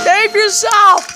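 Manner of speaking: dramatic shout. Acted. Chaos, character warns others.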